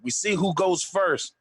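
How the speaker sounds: ordinary talk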